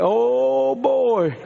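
long drawn-out mock dread; slow-motion "oh boy". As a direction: stretch it out as if time slowed down. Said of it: "see stuff in slow motion".

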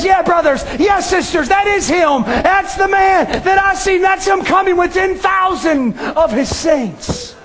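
shouting testimony from the pulpit; fervent preaching. Acted. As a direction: preach it out with full fervor. Preacher voicing Enoch.

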